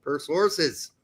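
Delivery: loud, high, punchy news read; news bulletin read. Acted. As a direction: announce it like a breaking sports ticker. Reads transfer news bulletin.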